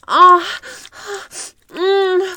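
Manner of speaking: ordinary talk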